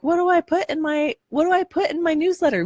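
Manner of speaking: high, flustered questioning; confused author voice. Retold or imitated. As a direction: mimic a puzzled beginner. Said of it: "So you're like".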